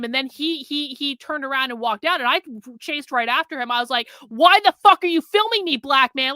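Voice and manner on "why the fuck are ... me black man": higher, louder angry shout at the man he chases; yelling. Retold or imitated. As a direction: yell it after him as he runs off. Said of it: "I started yelling".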